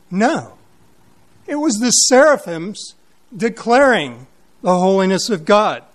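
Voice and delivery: usual speaking voice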